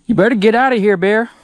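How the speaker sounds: loud, high shout at a bear; scaring off a bear. Real, not acted. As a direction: yell to drive the animal away.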